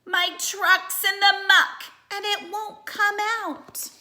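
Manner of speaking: high, loud distressed shout; stuck duck shouting across the water. Acted. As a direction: call for help from the muck. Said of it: "hears the shout".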